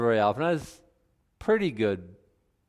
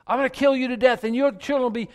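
much higher, louder hammed-up threat; mock wrathful God; 13 semitones higher, 5 dB louder, faster than usual. Joking how God talks when making a point, much higher and louder. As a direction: ham up an angry God. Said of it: "when God is talking like".

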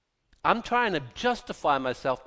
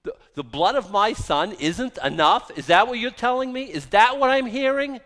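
louder, higher incredulous voice of God; 8 semitones higher, 7 dB louder than usual. Retold or imitated. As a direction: let God sound wounded and astonished, building up.

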